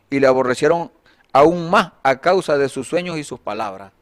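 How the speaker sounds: loud, projected Bible reading; declaimed verse with emphatic phrase openings; scripture reading. Acted. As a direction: read the passage out firmly to a congregation. Reads Genesis passage aloud.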